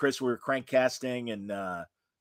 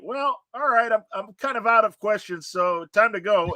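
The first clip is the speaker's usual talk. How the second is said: imitated reluctant sign-off; 7 semitones higher than usual. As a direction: lightly mimic a friend winding things up. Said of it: "the way he said goodbye".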